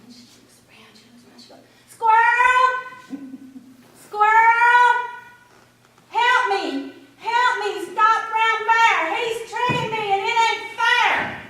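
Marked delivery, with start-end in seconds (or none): 1.9-11.4 high loud shout; rabbit calling out. A narrator's reading. Rabbit shouts up to Squirrel, high and loud. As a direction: shout up into the trees.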